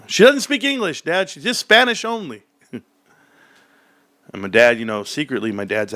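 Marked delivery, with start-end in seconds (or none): 0.1-2.4 put-on kid voice. Kids explaining to dad.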